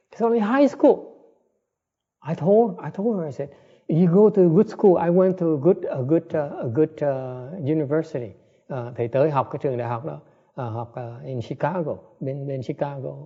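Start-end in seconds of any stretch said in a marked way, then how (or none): 0.1-8.3 much higher, emphatic storytelling; worked up. Real, not acted. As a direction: pitch it high with urgency. Recounting his own stress. (kể hăng hái, giọng cao hẳn)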